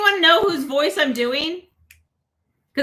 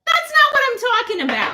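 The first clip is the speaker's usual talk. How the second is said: much higher, fast squeaky Harley Quinn voice; high-pitched Harley Quinn whine; 11 semitones higher, faster than usual. Pretend. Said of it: "Guess who I'm trying to be?".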